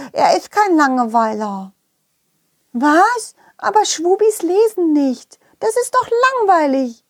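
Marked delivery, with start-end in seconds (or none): 2.7-7.0 high, small creature voice; high Schwubi voice. Acted. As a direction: play a little Schwubi, high.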